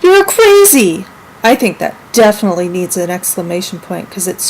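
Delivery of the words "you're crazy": high, loud storybook yell; excited yell. Acted. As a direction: yell it with strong emotion, stretching out "crazy". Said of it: "Clyde yelled".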